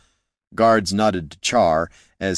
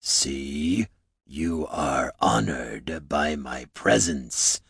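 low, slow, quieter thunder; Zerthraka; 6 semitones lower, 6 dB quieter, much slower than usual. A narrator's reading. Orc chief receiving the heroes at his throne, low rumble. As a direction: rumble it with lordly menace. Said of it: "a voice like distant thunder, low and promising coming fury".

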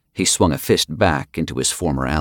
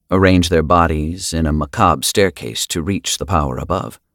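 plain reading voice

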